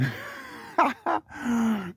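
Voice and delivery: long high laugh; high giggle. Real, not acted. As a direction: burst into a high cackle. Realizes his own mistake.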